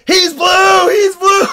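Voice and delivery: very high, loud mock warning yell; full-voiced reenacted holler; acted alarm shout. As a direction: scream it out to cause chaos. Pops up yelling at enemies.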